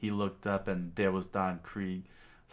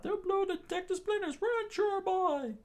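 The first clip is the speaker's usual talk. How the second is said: screamed warning. Acted. Chef tells chore boy to run, screaming. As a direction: scream a panicky alarm. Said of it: "the chef screamed out".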